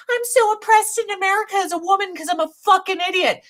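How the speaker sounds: high, rushed mock complaint; mocking whine. Mocking women who claim oppression in America, high sarcastic whine. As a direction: imitate a self-pitying complainer, fast and high.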